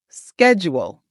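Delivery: "Schedule" opening with a hard sk; American "Schedule", sk as in sky; American SK pronunciation. Shown as a demonstration. Coach pronouncing it together with students, American SK. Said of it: "Remember, sk".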